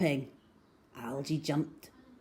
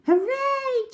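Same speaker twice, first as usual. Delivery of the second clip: put-on high, loud girl's cheer; 6 dB louder than usual. A narrator's reading. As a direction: shout hooray with joy. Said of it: "cheered Cherry".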